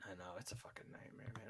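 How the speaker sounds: whispering. Real, not acted. Agreeing about hated website.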